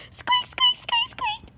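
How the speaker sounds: very high squeaks; mouse squeak. Pretend. Child playing mouse, very high squeaks.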